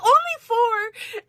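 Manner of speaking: very high, slow, drawn-out cheeky answer; high innocent wife shrugging it off. Retold or imitated. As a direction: say it sweetly as if it were nothing. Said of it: "She's like".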